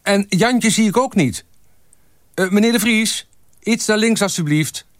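very high-pitched shouted directions; calling out. A narrator's reading. Photographer arranging staff for a group photo, calling out. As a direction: call instructions across to a group.

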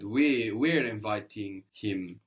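"We, we're" repeated slowly for emphasis; slow, emphatic "We, we're"; stress on WE. Shown as a demonstration. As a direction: press on "we're", taking it slowly. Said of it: "if we want to emphasize the we".

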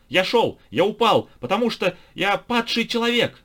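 high, loud madman howl; wild yell. A narrator's reading. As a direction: howl it like a raving lunatic. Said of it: "завопил безумец".

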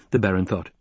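plain reading voice

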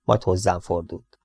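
ordinary delivery

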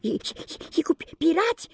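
put-on whispered, stumbling child's voice; the little one trips over his words; breathless stammer. The youngest brother whispering his wish to fight pirates.

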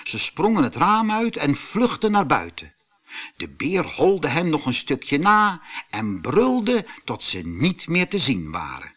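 usual speaking voice

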